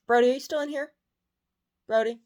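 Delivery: high, loud call for the dog. Real, not acted. Owner checks if her dog is still in the room, calling out. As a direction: call across the room to find him.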